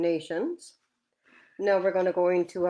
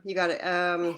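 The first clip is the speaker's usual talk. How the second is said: ordinary talk, nothing marked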